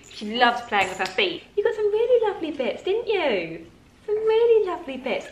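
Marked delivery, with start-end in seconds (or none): 1.6-5.3 much higher, sing-song coo. Real, not acted. Mother telling her baby about the gifts, high sing-song.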